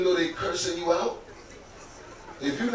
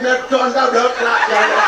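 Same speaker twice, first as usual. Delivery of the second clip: high, loud patois cuss; Jamaican cussing; 9 semitones higher, 7 dB louder than usual. Retold or imitated. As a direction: toss off a dismissive patois curse. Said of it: "She was like".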